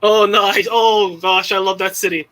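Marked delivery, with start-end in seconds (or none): none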